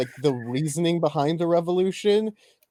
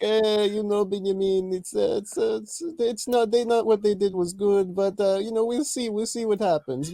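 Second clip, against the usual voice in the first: put-on higher, hesitant Israeli accent dad; lightly voiced Israeli father, pitched up; 4 semitones higher than usual. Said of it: "do it in your dad's, like, Israeli voice".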